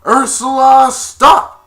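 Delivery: full, high-pitched royal order; commanding shout. Retold or imitated. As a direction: shout to halt the villain. King commands the villain.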